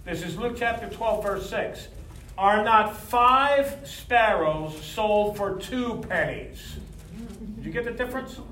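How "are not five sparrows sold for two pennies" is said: slow and deliberate. Shown as a demonstration. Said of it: "listen to the difference carefully".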